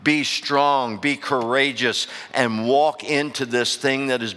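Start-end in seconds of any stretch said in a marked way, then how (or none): none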